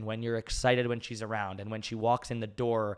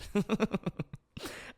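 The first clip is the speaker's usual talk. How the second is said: breathy laugh; 10 semitones higher than usual. Real, not acted. Laughs to close the answer.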